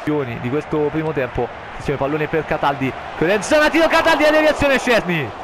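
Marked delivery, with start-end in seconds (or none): 3.2-5.3 rising, louder play-by-play; excited commentary. Acted. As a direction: call the save with excitement.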